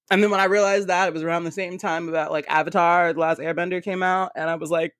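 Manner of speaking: ordinary talk, nothing marked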